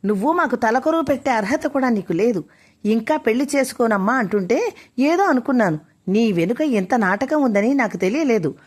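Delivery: ordinary delivery, nothing marked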